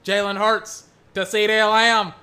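mock radio play-by-play; play-by-play call. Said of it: "Now I'm just casting football games".

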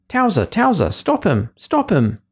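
high urgent call to the dog. A narrator's reading. Cook calls the dog to stop him, urgent call. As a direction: shout after the runaway. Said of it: "And then the cook called again".

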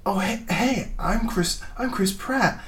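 high, soft mocking imitation of a goofy actor; mocking Chris Pratt. Mocking the actor to her co-host in a high, soft voice. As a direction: chirp it out like a dopey star. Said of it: "all these, like".

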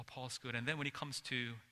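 usual speaking voice

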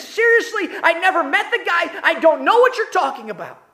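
high, loud denial with a nervous laugh. Retold or imitated. Peter brushing off the bystanders, a nervous laughing denial. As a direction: laugh it off, desperate to be believed. Peter denies a third time.

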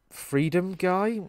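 mock correction. Real, not acted. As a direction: drawl it as a cheeky correction. Correcting a joke about the fascist line.

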